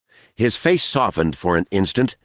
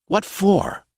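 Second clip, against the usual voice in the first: high casual voice; 12 semitones higher than usual. Acted. Smiley questioning casually.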